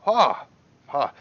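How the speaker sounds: loud, high "ha" of approval; delighted exclamation. Acted. Merchant just fired the crossbow, loud and delighted. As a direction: burst out with pleased surprise.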